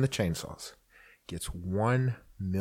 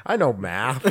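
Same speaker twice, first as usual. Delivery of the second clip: ordinary delivery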